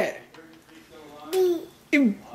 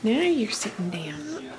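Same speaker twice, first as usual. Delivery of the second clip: whisper; 3 semitones lower, 7 dB quieter than usual. Real, not acted. Settling baby into chair.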